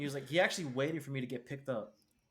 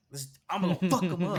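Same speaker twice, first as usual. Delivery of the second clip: angry threat; 5 semitones higher, 9 dB louder than usual. Retold or imitated. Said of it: "And he was like".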